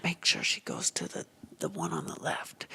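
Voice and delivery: urgent hushed whisper. Retold or imitated. Hiding in the dumpster, he whispers an order. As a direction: keep it secret, whispered.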